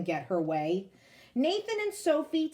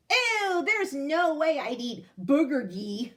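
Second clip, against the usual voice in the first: high, loud grossed-out ew; mocking schoolkid disgust; disgusted kid; 4 semitones higher, 6 dB louder, slower than usual. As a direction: recoil at the food like a snotty kid. Kid mocking new food.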